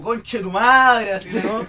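loud drawn-out curse; exasperated retold cry. Kid finding the presents already there, exasperated cry. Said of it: "yo quedaba como".

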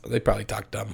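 ordinary talk, nothing marked

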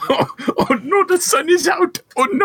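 put-on high, loud sing-song Swedish accent; panicking about the sun in Swedish accent. Continuing the Swedish Jack bit.

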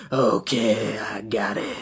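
low whispered ghost voice; a deep ghostly whisper; low haunting voice. Retold or imitated. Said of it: "not a bad impression".

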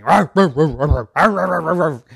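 loud barks; dog barking. Acted. Big dog barking at cat and bee, loud barks. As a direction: bark like a big bad dog. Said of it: "started barking".

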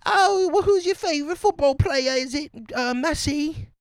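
very high squeaky British falsetto; hammed-up British onlookers quizzing him; British crowd voice. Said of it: "everybody else is like".

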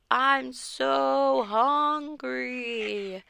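very slow, drawn-out monster moan; zombie moan. A narrator's reading. Fake zombie calling after the wagon, slow moan. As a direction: stretch it like a hungry ghoul. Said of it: "called out".

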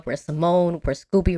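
normal talking voice

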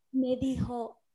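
ordinary talk, nothing marked

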